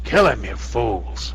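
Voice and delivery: slow rasping villain voice; raspy voice. Acted. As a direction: rasp it out slowly. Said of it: "a raspy voice kinda go".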